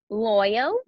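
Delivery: usual speaking voice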